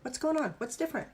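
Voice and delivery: the brain caught off guard, asking what's going on; surprised brain. Retold or imitated. Instructor voicing the brain puzzled by the other hand, surprised. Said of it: "your brain's going".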